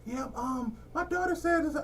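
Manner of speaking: lightly voiced tactful mother, fading out; mock polite lady. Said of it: "So she was like".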